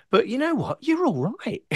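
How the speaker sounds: high-pitched northern bloke, grudging praise; high fan voice. Retold or imitated. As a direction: squeak it out like a grudging punter. Fans voiced after gigs.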